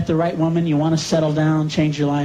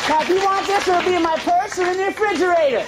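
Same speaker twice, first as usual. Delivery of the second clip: wife's voice; 8 semitones higher than usual. Retold or imitated. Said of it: "She'd go".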